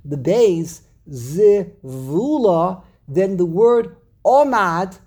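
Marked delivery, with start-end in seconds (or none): 1.1-2.8 "Zivula" slowly, leaning on VU; stress on VU. Shown as a demonstration. Teacher demonstrating the rule, slow middle-stressed "Zivula". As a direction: draw out "Zivula" with emphasis on the middle syllable. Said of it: "put the emphasis on the".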